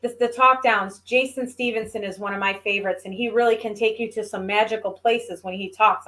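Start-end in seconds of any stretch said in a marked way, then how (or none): none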